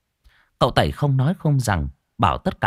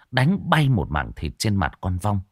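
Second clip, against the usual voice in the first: ordinary delivery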